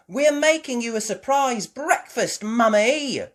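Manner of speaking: put-on high, loud little-girl voice; eager child explaining. Child telling Mummy about breakfast, eagerly explaining. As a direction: explain brightly at full volume. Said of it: "explained Peppa".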